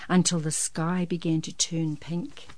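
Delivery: usual speaking voice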